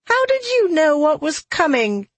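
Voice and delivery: high-pitched, sustained grumble; grumbling. A narrator's reading. Marrow reaching for travel clothes, grumbling. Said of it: "Marrow grumbled".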